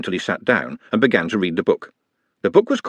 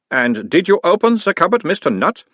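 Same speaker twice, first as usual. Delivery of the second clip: put-on higher, louder doctor's voice with a light Uberwald accent; mild Uberwald accent; 9 semitones higher, 3 dB louder than usual. A narrator's reading. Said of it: "mild Uberwaldian accent".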